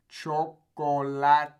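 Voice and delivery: "Chocolate" slow and loud as cho-ko-la-te; Spanish pronunciation. Shown as a demonstration. Teacher drills the O sound, slow Spanish pronunciation. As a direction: break "Chocolate" into long Spanish syllables. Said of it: "You really hear that O sound".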